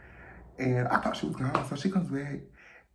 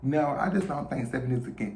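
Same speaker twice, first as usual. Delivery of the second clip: ordinary talk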